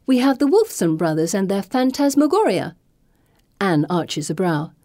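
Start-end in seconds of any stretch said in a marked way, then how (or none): 0.1-2.8 higher, showman-like mock enthusiasm; mock sincerity. A narrator's reading. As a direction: sell it with a straight face. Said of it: "I say with mock sincerity".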